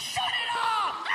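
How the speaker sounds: very high, drawn-out yell; long high mock shout; shouted order. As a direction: imitate a cop screeching a command through hysterics. Laughing cop orders music off.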